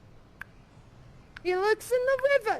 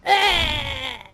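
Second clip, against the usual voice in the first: mock pain cry; 2 semitones lower, 5 dB louder than usual. Pretending to be stabbed.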